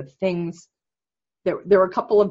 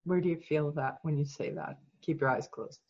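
soft, slightly lowered guiding voice; guided meditation; 2 semitones lower, 11 dB quieter than usual. Real, not acted. Coach guiding client through self-empathy exercise, soft meditation voice. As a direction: guide them gently inward, softly.

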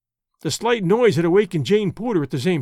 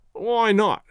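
mildly growled retort, pitched up; 4 semitones higher than usual. A narrator's reading. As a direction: throw it back with a light growl. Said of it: "growled Wilson".

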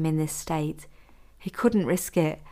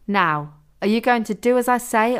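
higher, louder stern question; threatening demand; 5 semitones higher, 5 dB louder than usual. A narrator's reading. Captor warns the captive of punishment, threatening. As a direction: press for obedience, raised voice.

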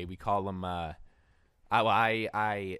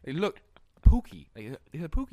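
higher, insistent voice; homeless man; 8 semitones higher, 10 dB louder than usual. Retold or imitated. Homeless guy insisting to the teller, high voice. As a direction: push the word like he's showing you.

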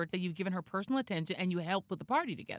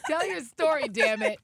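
laughing through the words, half crying; real laughter; 6 semitones higher, 5 dB louder, slower than usual. Real, not acted. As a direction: beg through helpless laughter. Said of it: "I can't".

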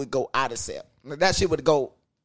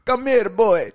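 put-on Jamaican accent, higher-pitched; 7 semitones higher, 4 dB louder than usual. Podcaster showing co-host a sexy accent, mock Jamaican. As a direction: call out in a playful Jamaican accent. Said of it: "they got the Jamaican accent".

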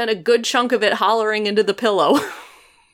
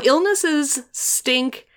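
ordinary talk

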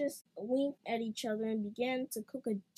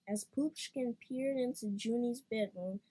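ordinary delivery, nothing marked

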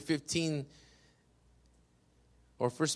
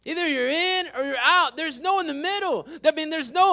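forceful preacher's cadence; preaching; 11 semitones higher, 7 dB louder than usual. Real, not acted. Pastor urging the church to be set apart, preaching. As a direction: punch the key words like a preacher.

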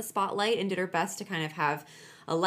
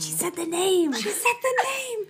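much higher, giddy repeat; excited squeal; 11 semitones higher, 4 dB louder than usual. Real, not acted. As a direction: squeal it with delight. Excited at show name.

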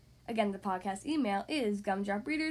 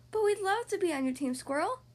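eager higher voice with an upward ending; bright; 6 semitones higher than usual. A narrator's reading. Rabbit asking to join Squirrel's team, bright. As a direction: offer it brightly and hopefully. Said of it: "said Rabbit brightly".

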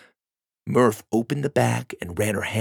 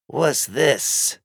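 irritable; 4 semitones higher than usual. A narrator's reading. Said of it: "she asked irritably".

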